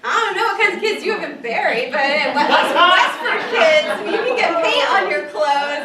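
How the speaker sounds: loud, high, laughing comeback; laughing banter. Real, not acted. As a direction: toss it back over the laughter. Joking reply amid audience laughter.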